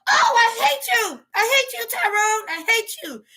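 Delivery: lightly voiced girlfriend sulking at Tyrone; mock angry girlfriend. Girlfriend voiced after smashing phone.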